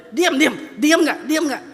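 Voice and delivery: loud, sharp, irritated telling off; scolding a dog. Acted. Owner scolding the barking dog, loud and annoyed. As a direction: shush a barking dog crossly.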